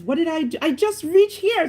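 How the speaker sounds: high-pitched mock innocence; acted protest. Driver protesting a ticket to the officer, high acted protest.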